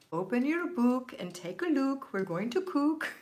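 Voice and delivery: high, loud imitation of an Irish nun's accent; imitating Irish nuns. Recalling childhood nuns' classroom rhyme in Irish accent. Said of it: "strong Irish accent ... they would say".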